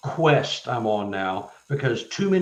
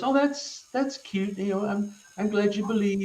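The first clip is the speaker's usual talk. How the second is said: patronizing; 9 semitones higher than usual. Retold or imitated. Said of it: "kind of patronizing me a little bit".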